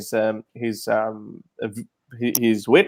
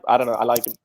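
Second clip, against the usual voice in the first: ordinary delivery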